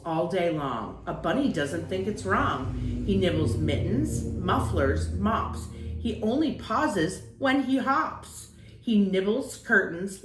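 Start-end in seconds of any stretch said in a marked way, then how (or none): none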